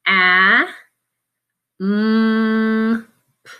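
sounding out A, mm, p; each sound said on its own; letter by letter, slowly. Shown as a demonstration. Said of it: "it is a C".

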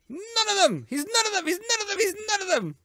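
high, strained repeating wail; a high near-crying wail over and over; 1 frantic repeated cry. Real, not acted. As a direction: keep crying it out high and desperate.

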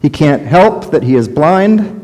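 normal talking voice

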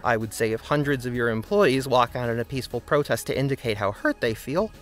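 ordinary delivery

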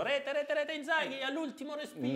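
high-pitched commentator goal call; football commentator call. Retold or imitated. Imita la telecronaca di Piccinini. (telecronaca del gol con voce acuta)

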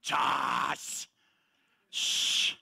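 scoffing tsk and hushing shh; mock scoffing. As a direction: sneer like a grumbling scribe. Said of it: "cavilaron con voz alta".

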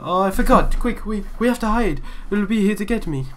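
high, loud alarm; panicked urgency. Acted. Boy remembers the monster is coming, high panicked voice. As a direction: urge someone to hide, frantic and stammering.

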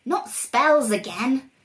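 a hint of croaking dismay; croaking toads. A narrator's reading. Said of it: "they croaked".

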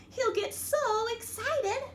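ordinary delivery, nothing marked

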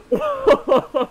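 sudden high-pitched giggle; high burst of laughter. Real, not acted. Gamer bursting into high laughter after crashing. Bumps a subscriber off track.

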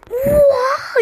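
high, loud startled oha; sudden loud exclamation; shocked shout. Real, not acted. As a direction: jolt of alarm.

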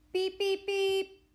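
high, loud imitated horn beeps; truck horn beeps. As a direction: beep high and loud on one held pitch. Imitates truck horn ending story.

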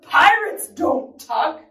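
loud, slow, emphatic refusal; bellowing. Acted. Captain refuses to tuck the child in, bellowing. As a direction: lay down the pirate law, word by word. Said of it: "he bellowed".

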